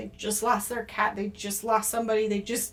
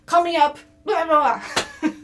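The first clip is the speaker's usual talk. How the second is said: imitating a frantic client, higher and louder; mock panic, demanding and rushed, trailing into blah blah; desperate client; 4 semitones higher, 6 dB louder than usual. Mocking a desperate client calling for a reading, frantic and loud. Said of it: "people can't just be like".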